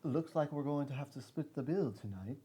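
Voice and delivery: put-on a soft, proper British accent; passable British accent. Podcast host tries British for a fan, quietly proper. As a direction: say it like a polite Englishman. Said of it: "It sounds proper".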